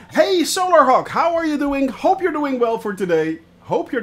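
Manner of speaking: bright high loud hello to a viewer; loud greeting. Real, not acted. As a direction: call out a happy hello to a fan. Greeting a chat viewer loudly.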